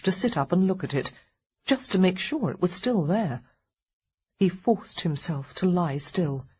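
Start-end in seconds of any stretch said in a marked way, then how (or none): none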